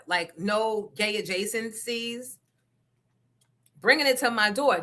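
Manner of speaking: usual speaking voice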